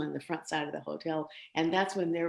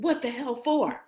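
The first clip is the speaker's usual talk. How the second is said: indignant dad; 8 semitones higher, 6 dB louder than usual. Retold or imitated. Dad protective on phone.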